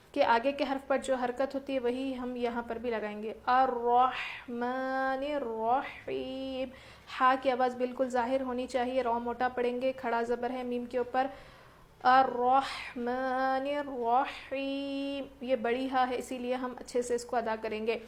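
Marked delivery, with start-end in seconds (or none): 3.5-6.7 slow melodic tajweed recitation of الرحمن الرحیم; slow melodic Quranic chant. Shown as a demonstration. Model recitation. 12.0-15.2 slow melodic tajweed recitation of الرحمن الرحیم; slow melodic Quranic chant. Shown as a demonstration. Model recitation.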